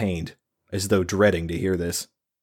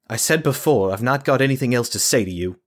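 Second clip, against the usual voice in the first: defensive woman's voice; 5 semitones higher than usual. Acted. Ilsa refusing, defensive at door.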